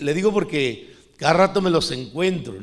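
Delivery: usual speaking voice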